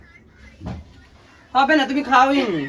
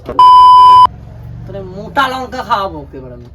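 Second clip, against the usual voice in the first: loud; 11 dB louder, slower than usual. Real, not acted. Playful threat during chili challenge.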